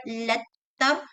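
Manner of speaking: "letter" split as "let-ta" with held T; shadda T. Shown as a demonstration. Teacher illustrating Arabic shadda, held T in "letter". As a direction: double the T in "letter", Arabic style. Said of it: "you would say with shadda".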